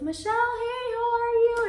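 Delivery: very high, loud sing-song greeting; bubbly client greeting. Retold or imitated. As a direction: gush it out like an overly cheerful acquaintance. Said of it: "she was like".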